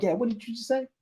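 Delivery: high, clueless "what did you just say"; oblivious listener. Retold or imitated. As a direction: play the one who wasn't listening. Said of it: "And then they're like".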